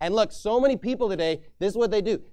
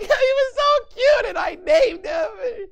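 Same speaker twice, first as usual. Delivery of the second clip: put-on very high, slow whining kid; hammed-up child crying; mock whining child; 5 dB louder, slower than usual. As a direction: wail like a child who named the dog. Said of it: "They're like".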